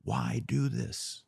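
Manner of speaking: ordinary talk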